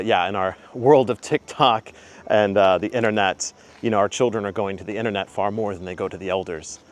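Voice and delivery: ordinary talk, nothing marked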